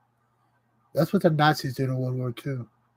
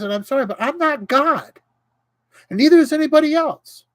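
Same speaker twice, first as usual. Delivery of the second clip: high-pitched, raised indignant ranting; heated rant; 9 semitones higher than usual. Real, not acted. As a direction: let the outrage pitch your voice way up. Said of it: "That's my rant".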